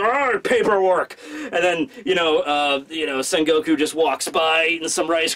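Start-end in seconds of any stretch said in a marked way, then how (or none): 0.0-1.2 slow, growled mock rage; frustrated growl. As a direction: growl it like a fed-up admiral. Sakazuki fuming over desk work.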